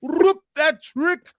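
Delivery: very high, stretched-out mock Middle Eastern chant; terrible Middle Eastern accent. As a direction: imitate a Saudi crowd chanting in a bad Middle Eastern accent. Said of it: "That's my terrible Middle Eastern accent".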